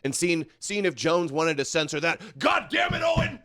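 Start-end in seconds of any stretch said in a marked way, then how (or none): 2.4-3.4 high, strained, furious yell at Owen; exasperated shout. Pretend. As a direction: blow up in frustration at Owen. Streamer voices an exasperated Jones.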